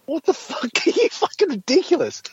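high-pitched disbelieving outburst; incredulous outburst. Real, not acted. Host reacting to mining-jobs argument, high incredulity. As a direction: squeak out the outrage.

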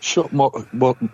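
ordinary talk, nothing marked

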